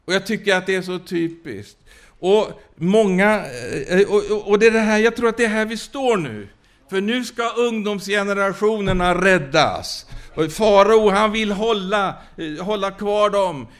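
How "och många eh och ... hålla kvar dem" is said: higher, louder revival urgency; preacher rallying the youth generation; urgent preaching. Acted. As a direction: push the point home to the congregation.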